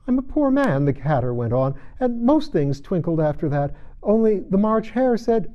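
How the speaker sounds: Hatter voice. Acted. Hatter continues.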